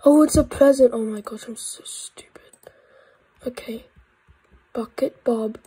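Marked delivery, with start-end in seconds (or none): none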